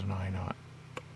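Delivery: slow, hushed whisper; slow whispered insistence. Retold or imitated. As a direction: breathe it under your breath. Quoting him quietly insisting.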